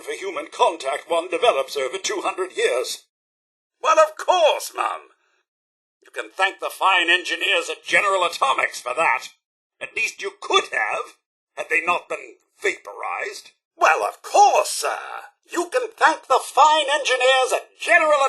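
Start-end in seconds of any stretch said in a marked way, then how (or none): 3.8-4.7 loud, high, delighted retort; proud assurance. Acted. Robot butler answers employer about surviving, proud exclamation. 13.8-14.7 loud, high, delighted retort; proud assurance. Acted. Robot butler answers employer about surviving, proud exclamation.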